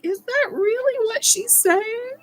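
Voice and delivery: high, slow disbelief; incredulous question. Retold or imitated. Viewer baffled by a baker's accent despite subtitles, incredulous. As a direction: puzzle it out slowly in a high-pitched voice. Quotes own puzzlement at thick accent.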